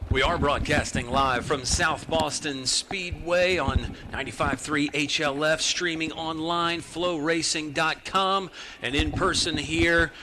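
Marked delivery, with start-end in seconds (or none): none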